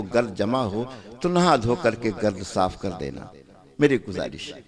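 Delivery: subdued, sorrowful-toned sermon appeal; hushed admonition. Real, not acted. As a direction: drop low and quietly plead with the congregation. Quiet admonition within sermon.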